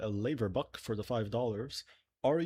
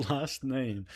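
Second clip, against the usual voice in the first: ordinary talk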